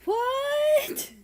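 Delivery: very high, loud startled cry; shocked gasp. Acted. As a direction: blurt it out in total shock. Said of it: "Cal walked in and gasped".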